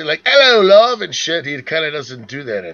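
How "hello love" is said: mock British accent, higher and louder; a cheeky British hello; British greeting. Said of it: "you would think he would say".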